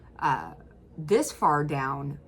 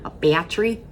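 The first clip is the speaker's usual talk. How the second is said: a loud mock upper Midwest "battery" said as "pattery"; laughing as she repeats the clip's Midwest "battery"; imitating upper Midwest; 9 dB louder than usual. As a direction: echo the clip's "A battery", louder and amused. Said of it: "they talk like".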